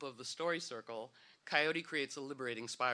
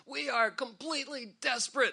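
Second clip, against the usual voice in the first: higher, louder mock despair; mock desperate plea; 8 semitones higher, 5 dB louder than usual. Mocking a news service email header, hammed-up despair. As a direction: ham up a begging charity email. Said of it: "the email header is always".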